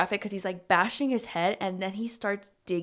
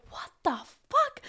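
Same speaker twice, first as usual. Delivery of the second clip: high, strained mock outcry; retold shock, pitched way up without volume. Jade rushes in on the bashing, high shocked cry.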